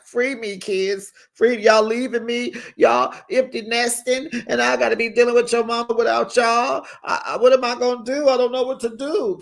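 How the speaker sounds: high, hammed-up pleading dad; mock crying plea. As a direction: plead up high as if imitating tears. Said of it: "maybe that's why he was really crying".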